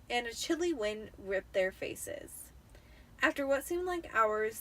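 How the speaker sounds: ordinary delivery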